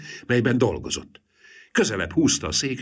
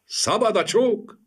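high, slow loud call; mischievous shout; 4 dB louder, slower than usual. A narrator's reading. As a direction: shout it out gleefully in the dark. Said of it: "kiáltá a kujon klempa".

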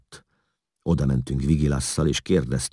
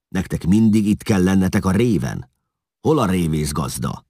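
raised, louder scolding voice; 5 semitones higher, 5 dB louder than usual. A narrator's reading. Eszlasz scolds his men at the ferry, cross and raised. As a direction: tell them off impatiently.

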